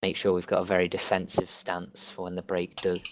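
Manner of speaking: ordinary delivery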